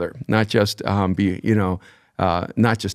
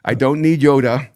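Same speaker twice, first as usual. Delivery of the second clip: put-on louder, higher, exasperated teen pleading with dad; impatient daughter; 4 semitones higher, 5 dB louder than usual. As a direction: imitate a fed-up daughter demanding a straight answer. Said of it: "our daughter, would go".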